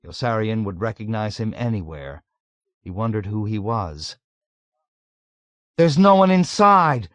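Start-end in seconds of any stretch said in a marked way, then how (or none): 5.8-7.1 high, loud alarmed yell; sudden yell. A narrator's reading. Dunbar unexpectedly yelling at his friend. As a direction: blurt it out at full voice. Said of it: "Dunbar yelled out at him".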